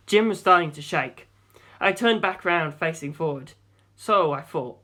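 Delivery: ordinary delivery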